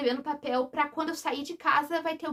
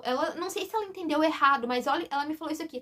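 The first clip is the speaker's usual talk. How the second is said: ordinary talk, nothing marked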